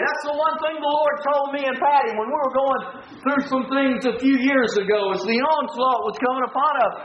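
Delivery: normal talking voice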